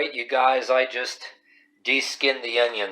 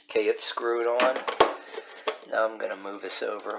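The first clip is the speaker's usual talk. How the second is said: ordinary delivery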